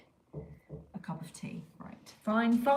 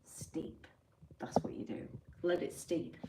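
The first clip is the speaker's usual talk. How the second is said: whispered correction; 8 dB quieter than usual. Shown as a demonstration.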